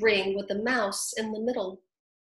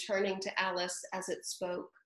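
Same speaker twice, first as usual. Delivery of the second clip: plain reading voice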